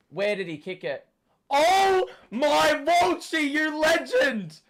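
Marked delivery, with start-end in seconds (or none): 1.5-4.6 slow, high, loud triumphant yell; ecstatic shout. Real, not acted. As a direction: drag out the celebration. Fan celebrating a goal.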